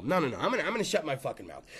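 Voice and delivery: usual speaking voice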